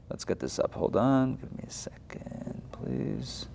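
whispered aside to self while fiddling with the screen; muttered under breath. Real, not acted. Teacher mutters to herself while sharing her screen, whispering. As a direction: mumble it to yourself, barely voiced.

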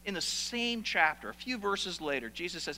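usual speaking voice

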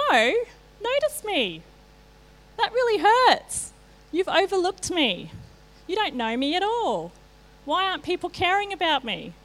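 voicing the hurt self who felt overlooked; wounded plea. Speaker retelling her hurt at being ignored, high and wounded. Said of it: "And it hit me really hard".

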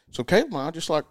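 usual speaking voice

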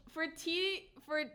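laughing. Real, not acted. Hosts laughing while trying to recall the show name. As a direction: laugh as you fumble for the name. Forgets her own podcast name.

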